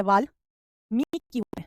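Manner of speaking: Valmiki drawn out very slowly; slow dictation. Shown as a demonstration. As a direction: spell out Valmiki for notes. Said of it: "teacher dictates answer". (धीरे-धीरे वाल्मीकि)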